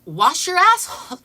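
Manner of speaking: loud, high shouted slogan; loud, high imitated protest chant; mock yell. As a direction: shout it at passersby. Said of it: "you hear".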